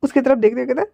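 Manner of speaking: ordinary delivery, nothing marked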